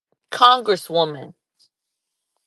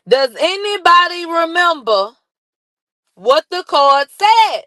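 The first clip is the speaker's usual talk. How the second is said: emphatic callout; 9 semitones higher than usual. Real, not acted. Repeats question emphatically.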